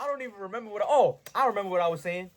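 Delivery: usual speaking voice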